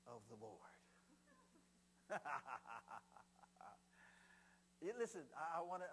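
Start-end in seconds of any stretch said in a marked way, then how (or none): 2.1-3.7 lower, falling string of ha-has; put-on laugh. Preacher modelling joy of the Lord, put-on laugh. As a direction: let out a deliberate chuckle that tapers away.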